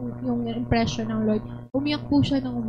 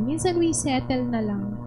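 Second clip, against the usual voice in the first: normal talking voice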